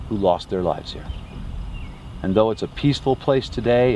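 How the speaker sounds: ordinary talk, nothing marked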